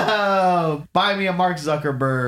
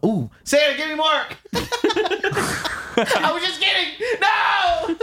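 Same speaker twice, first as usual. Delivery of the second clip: mock calling out; slower than usual. Host calling up to Santa for Mark, high laughing shout. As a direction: call after him as he flies off.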